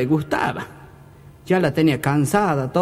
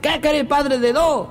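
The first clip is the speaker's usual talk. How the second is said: higher, drawn-out comic comeback; mock annoyed retort; 6 semitones higher, slower than usual. Fed-up wife to her husband at a party, mock annoyed retort. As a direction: imitate a fed-up wife answering back. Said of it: "Y ella, enojada".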